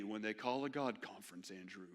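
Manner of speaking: a hint of a droning professor, low and quiet; deadpan, flat lecture delivery; monotone professor. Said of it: "in the most monotone voice".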